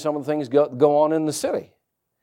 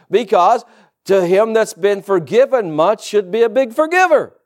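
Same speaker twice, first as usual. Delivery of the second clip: higher, louder, driving sermon voice; preaching at full force; 7 semitones higher, 5 dB louder than usual. Acted. Pastor urging believers to forgive, preaching hard. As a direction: build the fire and hammer every point.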